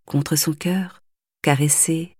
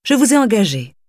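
stern, louder rebuke; severe; 4 semitones higher, 4 dB louder than usual. Acted. Mother sternly reprimanding the governess. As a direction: reprimand her coldly. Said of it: "commença sévèrement madame Kampf".

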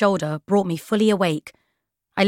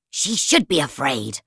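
venomous Poisons; 5 semitones lower, slower than usual. A narrator's reading. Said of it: "said Poisons".

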